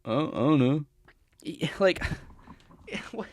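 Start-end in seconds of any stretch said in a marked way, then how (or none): 0.0-0.8 clip impression. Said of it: "that Joe Bart clip where he's going". 2.6-3.3 breathy laughing what. Real, not acted. Disbelieving laugh.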